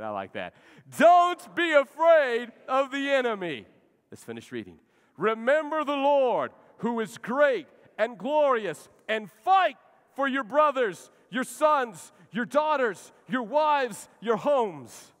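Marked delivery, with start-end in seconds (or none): 1.0-3.7 slow, high, loud declaration with full force; with gusto. Shown as a demonstration. Pastor leading the congregation to say it aloud with gusto, shouted slowly. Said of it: "Let's say that again". 5.2-15.1 high, loud, slow scripture proclamation; preaching at full force. Acted. Pastor reading Nehemiah's charge to the people, slow and loud.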